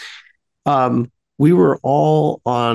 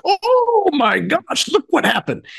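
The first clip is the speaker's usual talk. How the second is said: delighted amazement; 10 semitones higher than usual. Retold or imitated. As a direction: gasp it out in playful wonder, stretched high. Voicing delighted group reaction.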